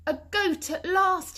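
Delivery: high, loud, delighted cry; excited cry. A narrator's reading. Troll spotting goat hoof prints, crying out in delight. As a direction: shout it out in glee. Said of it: "he cried".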